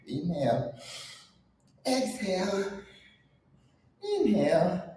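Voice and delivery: guided breathing. Acted.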